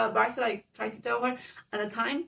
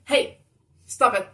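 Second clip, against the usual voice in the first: loud, sharp scolding stop it; firm shout; 10 dB louder than usual. Retold or imitated. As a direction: yell at mother to stop her outburst. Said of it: "sh-, not shouting".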